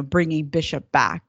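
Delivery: ordinary talk, nothing marked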